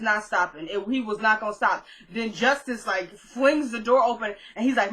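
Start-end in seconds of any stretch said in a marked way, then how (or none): none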